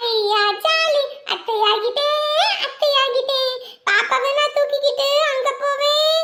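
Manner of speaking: put-on high sing-song little girl chanting; excited child. The girl chants happily about carrying the baby, high sing-song. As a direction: chant it like a thrilled kid.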